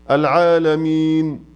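العالمين with a short two-count stretch on its last vowel; shortest madd. Shown as a demonstration. Teacher showing the three madd lengths, العالمين held briefly on the final ee. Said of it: "العالمين اتنين".

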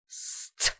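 unvoiced hiss; soft voiceless hiss sound; hissing sniff. Sound behind her ear.

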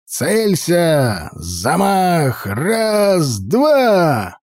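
rising commands ending in a shout; barked count. Acted. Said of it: "скомандовал ярл Олаф".

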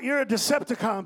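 ordinary talk, nothing marked

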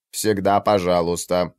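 flat machine translation voice; synthesized voice. Acted. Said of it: "ответил синтезированный голос".

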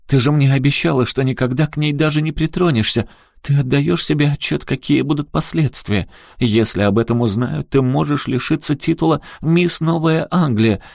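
high-pitched, agitated reproach; urgent, high-voiced lecture; upset scolding. A narrator's reading. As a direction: berate a child in dismay. Mother scolds daughter in distress.

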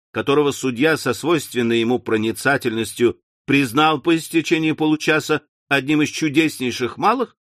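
ordinary delivery

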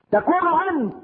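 put-on a high, cracking cry from a frightened boy; a touch of tearful pleading in the voice; weeping plea. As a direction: let the voice climb and break as if weeping. Anas weeping, voiced by preacher.

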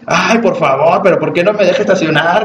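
adult tantrum. Retold or imitated. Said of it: "Es un berrinche grandotote".

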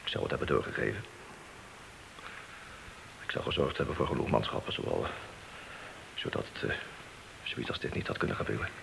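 hushed remorse. Acted. Guilty apology to wife.

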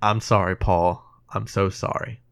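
low, soft and fading; quiet apology. Retold or imitated. Retelling Brenner's words in silence and blackness, quiet apology. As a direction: let the apology sink away to almost nothing.

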